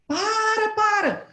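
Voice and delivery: high urgent stop call; man yelling stop. Retold or imitated. Traffic guard stopping the car, high urgent call. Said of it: "homem com apito na boca".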